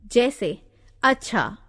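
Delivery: ordinary delivery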